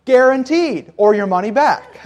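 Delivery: hint of an infomercial pitchman. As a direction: lightly play the TV salesman. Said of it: "It sounds like an infomercial".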